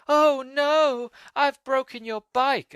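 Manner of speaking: high, slow, drawn-out fake sorrow; bully's exaggerated fake regret; mock dismay. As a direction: taunt with a hammed-up "oh no". Bully fakes dismay after breaking bike.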